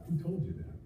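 gentle question with weight on Who; stress on who. Retold or imitated. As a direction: lean on the word Who in a hushed voice. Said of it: "the emphasis on the way God says".

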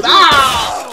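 high drawn-out yell of alarm; shouted scream. Real, not acted. Gamer hit by fire in game, screaming.

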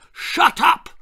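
very high, loud shouted roar; roaring father. A narrator's reading. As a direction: explode into a roar. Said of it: "roared his father".

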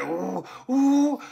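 high-pitched wailing siren noise; high "ooh, ooh" siren; imitated siren. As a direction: mimic a police siren with the voice. Imitates police cars pulling up.

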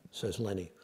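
ordinary delivery

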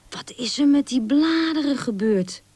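high, wondering question; astonished. A narrator's reading. Young squirrel sees changed leaves, astonished. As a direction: ask in open amazement. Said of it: "riep Rik verbaasd".

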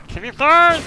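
high, loud demand, climbing at the end; frustrated shout. Real, not acted. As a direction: yell at the enemy in exasperation. Enemy will not burn.

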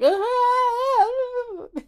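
high mock panicked wail; high freaked-out shriek. Vlogger imitating Kodo freaking out, high mock panicked shriek. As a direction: shriek like someone losing it. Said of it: "and Kodo's like, just like".

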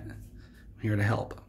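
hushed, slow whisper. Acted. Whispering to the kitchen staff, slow and hushed. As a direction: breathe it out slow and soft.

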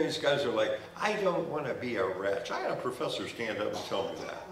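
ordinary talk, nothing marked

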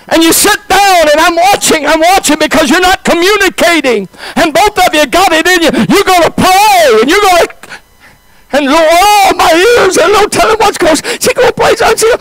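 high, fervent preacher's telling; fervent preaching. Acted. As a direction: raise the pitch and preach it with fire. Preacher's story, raised high and intense.

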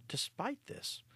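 ordinary delivery, nothing marked

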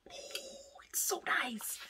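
hushed whisper of delight; awed whisper. Real, not acted. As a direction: breathe it out in awe. Opening pretty product in awe.

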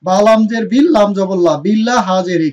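normal talking voice

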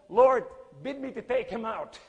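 crying out. Retold or imitated. Preacher retells begging the Lord for permission, crying out. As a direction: call out urgently in prayer. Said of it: "I start crying out to the Lord".

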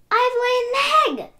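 high, loud thrilled cry; excited cry. Acted. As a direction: burst out with joy. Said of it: "cried Pig".

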